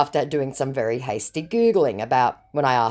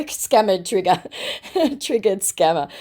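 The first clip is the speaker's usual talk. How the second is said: real laughter over a tongue slip; cracking up while correcting the words; laughing at slip; 5 semitones higher than usual. Real, not acted. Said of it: "Sorry, guys".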